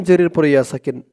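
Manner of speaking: ordinary talk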